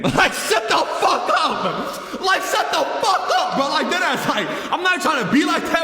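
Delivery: high, half-laughing mock outburst; exasperated rant. Storyteller telling off the drunk girl in his face, mock-angry high rant.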